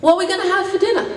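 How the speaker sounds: put-on high, fast whiny kid asking; a six-year-old pestering his big brother; little brother voice. Little brother in the backseat asking about dinner, high and fast. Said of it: "he couldn't say the J sound".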